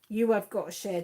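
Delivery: usual speaking voice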